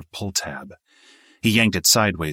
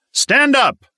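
barked order, much higher and louder; shouted command; 10 dB louder than usual. A narrator's reading. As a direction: bellow it like a jump master. Jump master barks orders.

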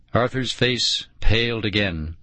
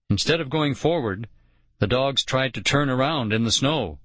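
ordinary delivery

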